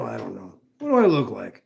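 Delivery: normal talking voice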